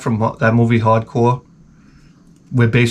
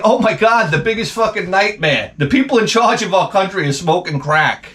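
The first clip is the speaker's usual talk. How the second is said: higher-pitched, hammed-up horrified cry; mock horror; 6 semitones higher than usual. Comic telling of a haunted house scare, mock horror. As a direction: exclaim in mock horror at a nightmare.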